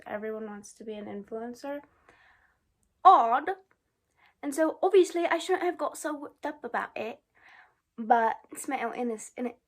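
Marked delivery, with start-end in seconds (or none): 3.0-3.5 loud dry remark. Real, not acted. Sharp reaction to comment. 4.4-9.5 put-on lightly done British accent, high and a bit loud; bad British accent. Vlogger mocks herself in bad Love Island British accent. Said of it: "I know that was a bad accent".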